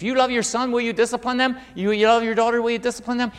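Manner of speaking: high, loud rhetorical questions; forceful preaching. Acted.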